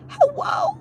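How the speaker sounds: very high drawn "Hello?"; shrieked mock disbelief; incredulous squeal. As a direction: squeal it in outrage. Exasperated disbelief retold.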